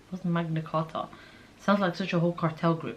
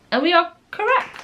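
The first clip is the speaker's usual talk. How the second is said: higher and louder, pleased with the right answer; excited; 10 semitones higher, 6 dB louder than usual. Real, not acted. Taking a citizenship quiz, cheering a correct answer out loud.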